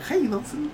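high-pitched mock lisping voice. Manager with a lisp calling out to Nelson, mock lisping. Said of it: "he had, like, a lisp".

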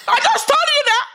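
high, laughing mock dad; exasperated dad. Dad scolding the child over maths, in a high mock exasperated voice. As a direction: play the impatient father, half laughing.

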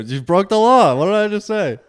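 mock cop scolding. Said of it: "What did I do".

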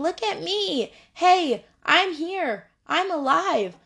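acted call to be noticed; crying out for attention. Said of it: "she is crying out for us".

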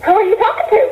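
put-on as a small kid tugging at Granny; child asking granny. Grandchild curiously asking Granny, in a high childlike voice. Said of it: "and I'd say".

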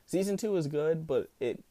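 ordinary talk, nothing marked

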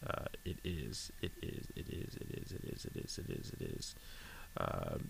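repeated under the breath, fading away; muttering while looking a name up on a tablet; muttering to self. Real, not acted. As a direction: mumble it over and over, barely audible.

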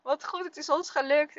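retold motherly cheer; cheering along. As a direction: join in a child's celebration. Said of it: "ik ook natuurlijk meedoen".

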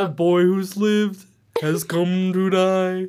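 slow, loud, high villain boom; Voldemort line. Retold or imitated. TikTok trend mimicking Voldemort, loud and slow. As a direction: intone it like a dark lord. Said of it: "doing the Harry Potter".

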